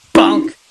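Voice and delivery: very loud high bonk; loud bonk effect. Acted. Narrator voicing the blow, loud comic bonk. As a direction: slam out the impact word.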